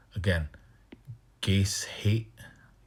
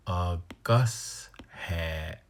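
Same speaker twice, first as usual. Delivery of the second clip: a Gus hat with a long drawn hat; very slow demo; much slower than usual. Shown as a demonstration. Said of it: "You got that lengthened hat".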